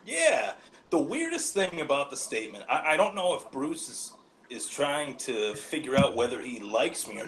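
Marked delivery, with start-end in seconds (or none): none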